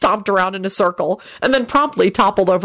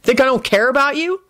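irritated, higher-pitched question; irritable; 7 semitones higher than usual. A narrator's reading. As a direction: ask it with irritation. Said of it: "Henry said irritably".

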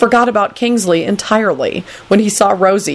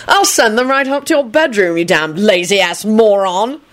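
raised pitch, slowing on the insult; exasperated threat; 5 semitones higher than usual. Acted. Kingsley threatens Lupin, exasperated. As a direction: climb high, then drag out the insult.

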